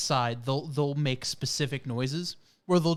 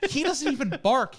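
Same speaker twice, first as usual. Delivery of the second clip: ordinary delivery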